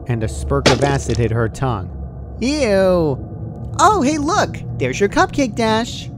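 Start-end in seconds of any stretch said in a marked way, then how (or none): 2.4-6.1 very high, loud excited squeal; gleeful Pinky. A narrator's reading. Pinky showing the unconscious Dash a cupcake, loud high squeal. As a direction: jump from disgust to glee.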